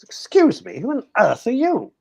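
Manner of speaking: high, plummy posh gent asking. Retold or imitated. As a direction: play an affronted upper-class chap. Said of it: "It was all very posh".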